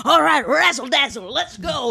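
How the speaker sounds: high showbiz razzle-dazzle voice. Acted. High-energy partner performing at home, showbiz razzle-dazzle. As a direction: belt it out like an over-the-top stage star. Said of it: "You're doing [name] again".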